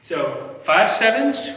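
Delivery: usual speaking voice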